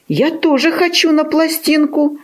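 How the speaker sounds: high, lightly wistful puppy voice; wistful sigh. A narrator's reading. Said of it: "вздохнул Тявка".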